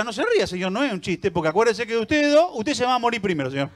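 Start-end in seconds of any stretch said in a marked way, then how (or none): none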